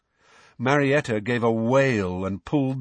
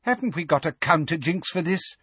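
high, peevish question; fretful official impatience; impatient; 7 semitones higher than usual. A narrator's reading. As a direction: demand a fix impatiently, pitched up. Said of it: "Fudge asked Umbridge impatiently".

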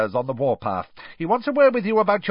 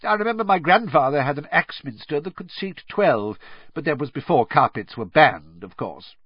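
higher start, settling into firm assurance; pointedly law-abiding; 6 semitones higher, 3 dB louder than usual. A narrator's reading. Crouch stressing his ancestors obeyed the law, pointedly. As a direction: leave nobody in any doubt. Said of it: "He spoke as though he wanted to leave nobody in any doubt".

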